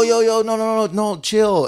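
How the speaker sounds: high, hurried backing down; panicked pleading. Retold or imitated. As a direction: beg them to calm down, mock panic. Group panics at threat.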